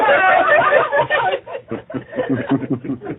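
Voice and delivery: short burst of laughing; laughter. Real, not acted. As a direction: laugh out at the punchline. Laughter after joke.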